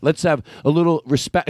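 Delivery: usual speaking voice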